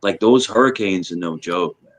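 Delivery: usual speaking voice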